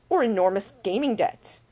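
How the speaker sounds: usual speaking voice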